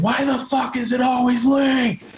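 high, drawn-out anguished shout; retold scream. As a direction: scream it slowly in helpless frustration. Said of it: "And I scream".

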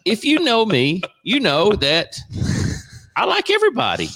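high-pitched, laughing self-defence; laughing protest. Real, not acted. Host defending himself to teasing cohosts, laughing protest.

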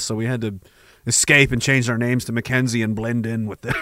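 ordinary delivery

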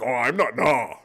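loud, dopey slowed caricature; oblivious dumb bro. Acted. Zombie sheep boasting he's a rebel, dopey mockery. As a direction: play a lumbering self-styled rebel. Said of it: "they think you're that thing. Like".

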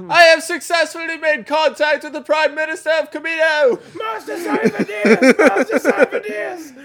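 high shouted hologram report; shouting. Retold or imitated. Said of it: "he's just like".

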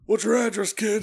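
Jake asking. Retold or imitated. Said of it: "he goes".

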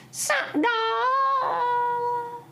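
high, sung-out creepy cat call; eerie cat voice. Acted. Witch cat croons at Jack for his gravy.

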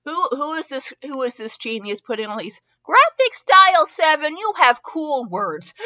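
high-pitched, excited praise; gushing amazement. Real, not acted. Reader praising the writer's cool words, gushing.

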